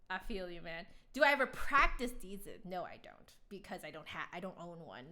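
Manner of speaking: ordinary talk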